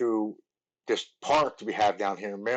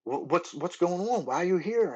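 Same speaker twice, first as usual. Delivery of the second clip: surprised; 8 semitones higher than usual. Retold or imitated. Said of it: "he's like".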